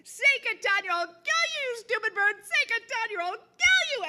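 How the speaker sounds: very high, loud furious screaming; murderous shout. Acted. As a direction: scream the threat in a murderous rage.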